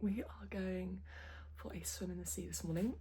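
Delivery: early morning whisper. Real, not acted. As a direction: whisper so nobody wakes. Whispering at dawn.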